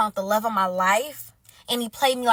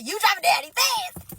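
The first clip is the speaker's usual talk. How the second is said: raised pitch, forceful push to the end; hammed-up fighting talk; hyped challenge; 6 semitones higher than usual. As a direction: punch it out high. Challenges the other woman.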